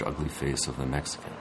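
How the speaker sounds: normal talking voice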